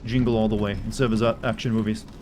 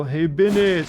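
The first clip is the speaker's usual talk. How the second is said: Arnold quote; 5 semitones higher, 4 dB louder, much slower than usual. Pretend. Quoting Total Recall Arnold.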